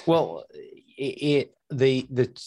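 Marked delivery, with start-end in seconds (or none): none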